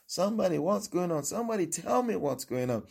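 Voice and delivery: high, pleading call for an answer; acting out a blind man calling out; acted scream. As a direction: demand urgently to be told. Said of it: "or scream".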